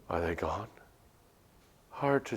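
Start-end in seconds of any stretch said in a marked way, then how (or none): 0.1-0.7 whisper, afraid to be heard; timid whisper. Acted. Anita checking if creatures left, whispering.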